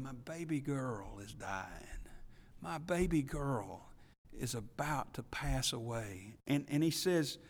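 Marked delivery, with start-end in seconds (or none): 0.0-6.3 much softer, breaking plea; whisper-close despair of a parent; desperate hush. Retold or imitated. Preacher as a modern father whose girl is dying, hushed despair. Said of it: "can't you just hear the desperation in his voice".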